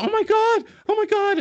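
high mock nurse exclamation; imitating the nurse. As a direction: squeak it out like the panicking nurse. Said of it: "she's going".

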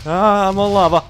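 loud alarmed cry; alarmed shout. Real, not acted. Panics standing on lava.

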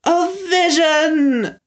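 put-on high, slow, thrilled old man; enthusiastic shout. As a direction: cry out in delight. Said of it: "shouted enthusiastically".

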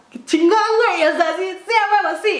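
high, excited cheering; thrilled. Retold or imitated. Reacting to Arturo's offer to tattoo her, thrilled.

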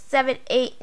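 ordinary talk, nothing marked

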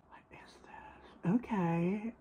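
awed breathless whisper; stunned whispering. Real, not acted. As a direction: gasp then whisper it in disbelief. Unboxing a luxury gift.

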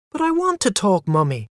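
put-on high-pitched small child's protest; crying-out child. A narrator's reading. Small boy to his mother who wants him silent, protesting. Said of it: "I cried".

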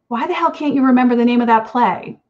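plain reading voice

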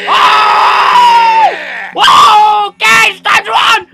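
very loud, high shriek; hammed-up shrieking panic; panicked screaming. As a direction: scream a warning to flee. Panicking as the chase starts.

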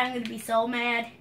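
usual speaking voice

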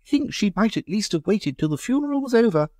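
high, shocked lady's voice; scandalised. Acted. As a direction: sound properly outraged. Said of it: "in a scandalised voice".

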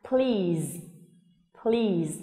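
"please" in one falling beat, long ee into z; one syllable, final z. Shown as a demonstration. Teacher contrasting two words, one-syllable "please" ending in z. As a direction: blend pl and end "please" on a buzz. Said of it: "có một âm tiết và âm cuối cùng là âm z".

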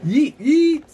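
loud high yeet; excited yeet. Real, not acted. Gamer celebrates loudly to friends, excited yell. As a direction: shout it in triumph.

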